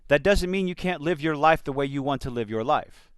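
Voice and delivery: ordinary delivery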